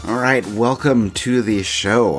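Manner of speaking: ordinary talk, nothing marked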